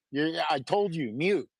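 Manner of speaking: normal talking voice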